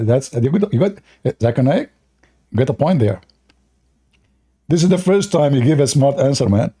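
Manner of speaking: ordinary talk, nothing marked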